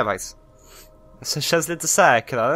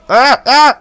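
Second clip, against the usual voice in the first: whimpering; 10 semitones higher, 12 dB louder, much slower than usual. Real, not acted. Frustrated whimpering.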